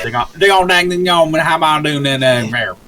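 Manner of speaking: mumbled Southern. Retold or imitated. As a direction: mushmouth Southern mumble like Boomhauer. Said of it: "couldn't understand me 'cause I was".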